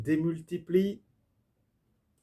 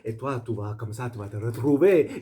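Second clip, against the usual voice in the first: light mock Spanish accent in French; a hint of a Spanish tango dancer; 3 semitones lower, 4 dB louder than usual. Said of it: "j'ai fait cet accent".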